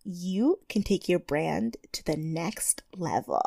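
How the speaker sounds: put-on soft whispery little-girl voice; breathy baby-talk host read; Tyra baby voice. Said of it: "Tyra Baby voice is on point".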